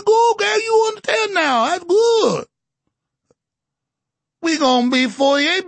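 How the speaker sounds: high-pitched, hammed-up Cajun executive; Cajun accent. Executive overruling engineers on mud, mock Cajun accent. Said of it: "executive in the coon-ass voice".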